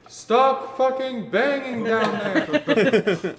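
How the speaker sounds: slow, high, loud shout from down the street; distant neighbour yell. Acted. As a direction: yell it across the street at the noise. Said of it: "you hear somebody yell".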